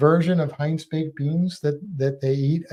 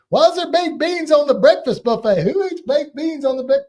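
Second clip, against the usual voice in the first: much higher, louder mock American accent; default American accent; 11 semitones higher, 7 dB louder than usual. Americans baffled by the breakfast buffet, high American accent. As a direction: play a loud American tourist, pitched way up. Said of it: "do my default American accent".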